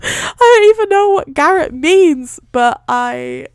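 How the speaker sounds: loud, high, through real laughter; laughing. Real, not acted. Reader amused by garrote line, laughing hard. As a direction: crack up and keep talking over it.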